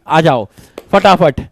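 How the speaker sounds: usual speaking voice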